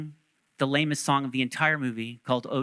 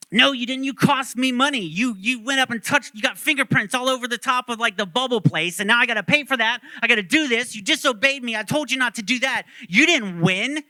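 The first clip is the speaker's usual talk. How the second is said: high-pitched, loud irate rant; acted-out furious scolding; 6 semitones higher, 5 dB louder than usual. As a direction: tear into the kid, piling up charges. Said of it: "he's, like, irate with them".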